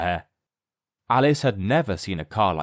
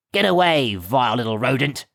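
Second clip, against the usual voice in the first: a nasty servant snarling; 3 semitones higher, 4 dB louder than usual. A narrator's reading. Gawk moves to grab Alice, snarling. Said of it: "snarled Gawk".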